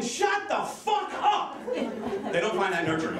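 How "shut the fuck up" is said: drawn-out, high-pitched mock scream; high, stretched-out comic yell. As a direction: scream it slowly, stretching every word.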